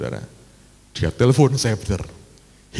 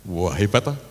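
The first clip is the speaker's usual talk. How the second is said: usual speaking voice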